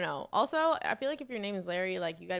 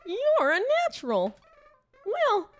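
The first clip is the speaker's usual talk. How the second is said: high-pitched cartoon tutorial character; higher, hammed-up character voice; game guide; 12 semitones higher, 5 dB louder than usual. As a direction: play the goofy guide, pitched up.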